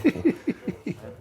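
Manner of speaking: a high, rising laugh. Real, not acted. Host laughing at his own joke, high laugh. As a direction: let a high laugh ride up. Joking about calling a hit.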